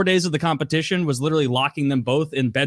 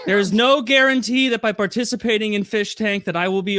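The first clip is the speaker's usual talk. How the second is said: raised, higher reading voice; reading out louder; 7 semitones higher than usual. Retold or imitated. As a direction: read it out as if told to speak up. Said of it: "[name], louder!".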